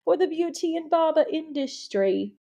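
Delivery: put-on British Cockney accent. Podcast host greets her loves in a put-on British Cockney accent. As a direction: greet the listeners like a playful Cockney Londoner. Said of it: "my British Cockney accent".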